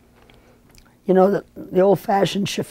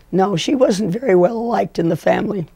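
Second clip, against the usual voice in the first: normal talking voice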